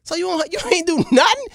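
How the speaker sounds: high, loud, fast disbelief; indignant king. Acted. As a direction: confront the lazy servant. King confronts servant.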